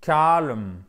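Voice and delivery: "Calm" as KOH-lun, drawn-out first vowel; diphthong O. Shown as a demonstration. Teacher demonstrates the double sound just explained, O diphthong. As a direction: glide slowly from E to O in "Calm". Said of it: "C'est un E qui va sur un O".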